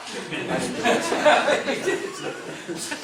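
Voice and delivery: slow words stretched through a laugh. Real, not acted. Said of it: "I need a rest right now".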